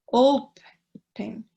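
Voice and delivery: "Open" in two separate beats, "Op... en"; split syllables. Shown as a demonstration. Teacher showing the stressed syllable, "Open" split as "Op... en". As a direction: break "Open" apart, pausing after the first syllable. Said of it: "Dos sílabas".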